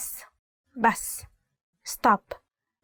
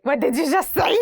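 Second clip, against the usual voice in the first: higher-pitched mock outrage, rising at the end; indignant mom; 5 semitones higher than usual. Mom to child who muttered under their breath, mock-indignant. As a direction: play a mom challenging backtalk. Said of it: "the mom is like".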